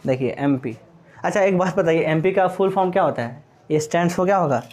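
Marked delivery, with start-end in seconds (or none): none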